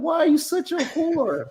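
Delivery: high whiny mocking voice; simp voice. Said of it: "Y'all be on here trying to be like".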